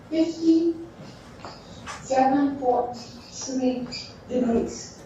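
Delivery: each number spelled out slowly and clearly; slow down so they can write it; slow dictation. Shown as a demonstration. Teacher dictating the angle to students, slow and deliberate.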